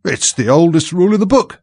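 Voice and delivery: high, loud defensive retort; insistent retort. A narrator's reading. King defending his rule to Alice, high loud insistence.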